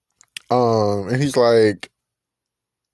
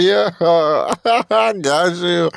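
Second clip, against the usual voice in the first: laughing reply; 6 semitones higher, much slower than usual. Retold or imitated. Girl answering his facial joke.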